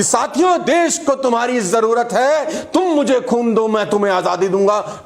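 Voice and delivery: fiery, high-pitched rallying speech; Netaji Subhash Chandra Bose. Acted. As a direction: rouse the crowd with blood in your eyes. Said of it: "आंखों में खून उतार के बोल रहे हैं". (जोशीला, ऊँचे सुर का भाषण)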